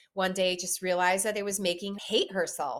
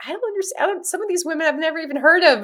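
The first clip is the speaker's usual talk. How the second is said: mock bewildered; 10 semitones higher, 7 dB louder, much faster than usual. Her baffled inner voice.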